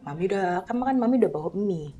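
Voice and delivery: put-on mother tells her child they can't afford the mall food; sad soft confession. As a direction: speak gently, holding back tears. Mother explains no money, sadly.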